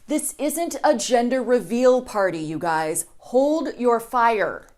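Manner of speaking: stretched very slowly, each word held; slow drawn-out command. Acted. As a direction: draw out the order like pleading. Mock command to military.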